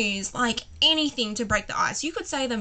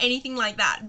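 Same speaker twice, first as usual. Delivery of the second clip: normal talking voice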